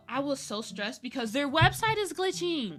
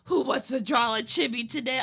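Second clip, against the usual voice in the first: louder husband line broken by laughter; laughing husband; 6 dB louder than usual. Retold or imitated. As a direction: coax through giggles. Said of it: "He's like".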